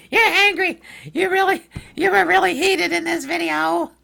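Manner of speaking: teasing parody of a complaining viewer; mocking accuser. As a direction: squeak out the viewer's accusation in mockery. Mocks viewer calling him angry.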